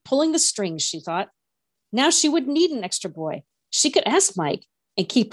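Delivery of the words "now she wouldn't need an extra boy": ordinary delivery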